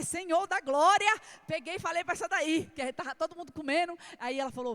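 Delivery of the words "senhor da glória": slow, much louder and higher cry; loud cry to God. Retold or imitated. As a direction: call out to the Lord, slowly. Cries out to God in worry. (clamor alto e lento ao Senhor)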